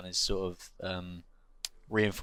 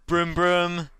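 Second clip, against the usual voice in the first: higher, playful vroom of a whole room; mock engine noise; 7 semitones higher, 4 dB louder than usual. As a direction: chant it like a revving bus. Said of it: "everyone in the room went".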